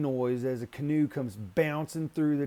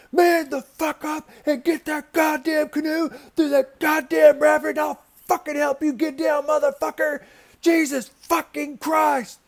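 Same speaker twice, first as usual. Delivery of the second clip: high, loud enraged scream at full force; furious screaming; 13 semitones higher, 8 dB louder than usual. Retold or imitated. Screaming at Eric to paddle the canoe, furious yell. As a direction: lose it completely and scream him down. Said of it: "I just start screaming at him".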